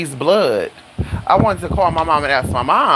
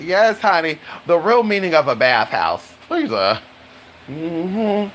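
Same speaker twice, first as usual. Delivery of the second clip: normal talking voice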